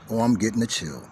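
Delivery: ordinary talk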